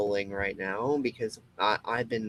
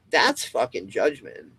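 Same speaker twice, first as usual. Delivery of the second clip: normal talking voice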